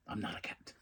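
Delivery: breathy whisper of a secret. Retold or imitated. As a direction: whisper it like a confession. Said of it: "Harley's like".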